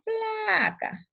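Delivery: "flaca" with a long, high first A; sonido alargado. Shown as a demonstration. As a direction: stretch "flaca" slowly into "flaaaca". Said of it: "fíjate cómo el sonido ha cambiado". («flaca» con la primera A muy alargada)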